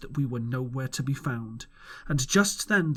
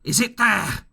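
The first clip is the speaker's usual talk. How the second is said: higher, louder roared question; 7 semitones higher, 6 dB louder than usual. A narrator's reading. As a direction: roar it up at the window. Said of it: "roared Pew".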